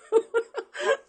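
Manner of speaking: high burst of laughter. Real, not acted. Joking about her husband's reaction, laughing.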